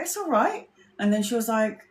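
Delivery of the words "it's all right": bright high-pitched reassurance; high reassuring. Retold or imitated. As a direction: reassure her in a much higher voice. Reassuring the apologizing stranger.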